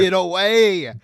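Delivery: loud, high urgent warning; frantic yell. Retold or imitated. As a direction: shout the warning too. Joins yelling at May.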